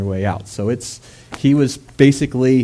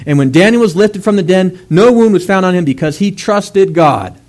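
loud, higher, driving sermon voice; full-force preaching; 7 semitones higher, 6 dB louder than usual. Acted. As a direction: preach it out to the congregation.

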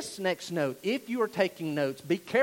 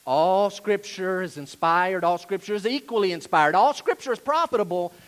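loud, hammered "All" each time; emphatic restatement; 7 dB louder than usual. Real, not acted. Preacher reassuring shocked listeners, loud and emphatic. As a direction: drive each statement home from the pulpit. Restating disputed claim after mock objection.

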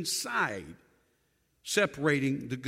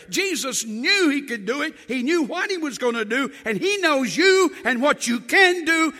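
loud, higher rising sermon climax; fervent loud preaching; preaching at full force; 6 semitones higher, 7 dB louder than usual. Real, not acted. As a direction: build the congregation up.

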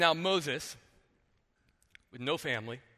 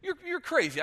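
incredulous mock retort; 12 semitones higher, 3 dB louder than usual. Pastor mocking Pharaoh's daughter.

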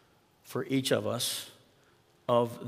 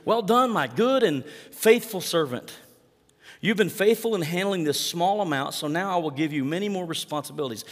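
praising master; 5 semitones higher, 5 dB louder than usual. Acted. Master praises faithful servant, hammed-up warmth. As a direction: proclaim it like a proud master.

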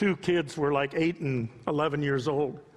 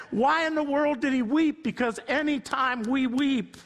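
high, rising preacher's cry; building sermon climax; impassioned preaching; 9 semitones higher than usual. Real, not acted. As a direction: preach it up to the rafters.

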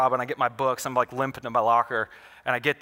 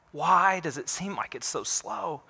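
drawn-out question, pitched a little high; slow, weary questioning; 4 semitones higher, 3 dB quieter, slower than usual. Acted. Believer asks God why justice lags, slow questioning. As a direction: stretch the question out slowly.

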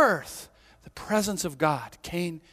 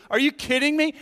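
sarcastic; 9 semitones higher, 4 dB louder than usual. Retold or imitated. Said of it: "is sarcastic to the judge".

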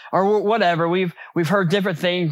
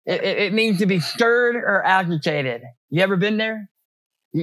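ordinary talk

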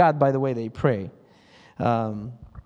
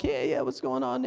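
casual chat; 6 semitones higher, 6 dB quieter than usual. Retold or imitated. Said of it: "they'll be talking normal".

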